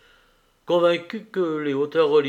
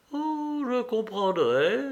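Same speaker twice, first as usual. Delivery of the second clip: with a smile; 7 semitones higher, slower than usual. Acted. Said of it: "avec un sourire".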